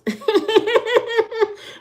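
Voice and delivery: high, giggly burst of laughter; laughing. Real, not acted. Amused that her sister did the hair, laughing.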